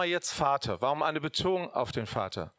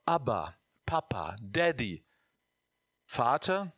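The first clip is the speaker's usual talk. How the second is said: hushed, loving "Daddy"; tender whisper; 4 semitones lower, slower than usual. Real, not acted. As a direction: breathe the word "Daddy" intimately. Tender names for God.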